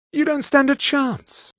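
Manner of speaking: high-pitched scornful taunt; sneering battle cry; combat taunt. Acted. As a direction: taunt your opponent, voice pitched well up. Villain taunts opponent in fight.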